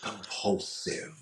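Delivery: slow, low menacing delivery; sinister monologue. Acted. Wrestler delivering a dark promo about blood and fanatics, slow and menacing. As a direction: drop low and draw each phrase out, sinking toward a whisper.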